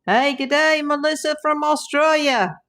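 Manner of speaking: put-on a playful, not-so-good Australian accent; bad Aussie accent. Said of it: "my Aussie accent's not so good".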